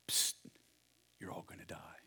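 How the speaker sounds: unvoiced stage whisper. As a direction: lean in and whisper the secret. Comic whispered aside.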